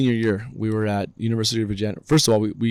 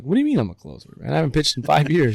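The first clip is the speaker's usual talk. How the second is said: higher-pitched, mock disbelief; incredulous retelling; 6 semitones higher, 3 dB louder than usual. Player to coach on being named closer, incredulous. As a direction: question it like you can't believe it.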